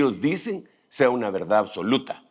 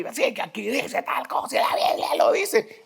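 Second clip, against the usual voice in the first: much higher, squeaky-voiced imitation; mock proof-texter; 13 semitones higher than usual. Preacher imitating brethren who fire off verses, high mocking voice. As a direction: parody someone firing off verses in a high voice. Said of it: "se las disparan ahí".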